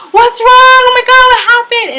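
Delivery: high, loud panicked questions; mock alarmed cry. Acting out everyone rushing out of the hostel, alarmed high cry. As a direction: imitate a crowd crying out in alarm.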